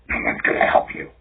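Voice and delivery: whispered demonic phone threat; eerie, breath-only demon impression. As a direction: imitate a creepy demon in a whisper. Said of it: "trying to act like a demon".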